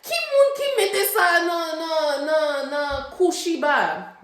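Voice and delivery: high, loud Haitian Creole mom demanding. Retold or imitated. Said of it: "She's like".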